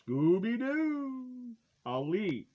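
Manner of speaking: ordinary talk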